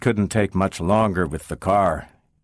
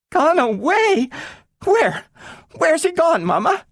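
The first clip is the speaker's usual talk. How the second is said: put-on girl learning Robbie is gone; shocked girl. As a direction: cry out in disbelief. Child in shock.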